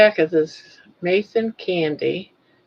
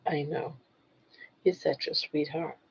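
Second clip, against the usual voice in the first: talking to a dog; 10 dB quieter than usual. Real, not acted. Soft cooing to her dog.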